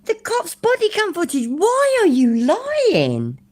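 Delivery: high squeaky mock voice. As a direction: squeak it out like a mocking kid. Mocking a high voice at liar.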